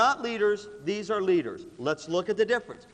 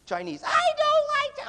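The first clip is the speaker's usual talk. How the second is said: very high shrieked objection; mock tantrum; 6 dB louder than usual. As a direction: squeal it like a picky complainer. Voices the self-centred complainer.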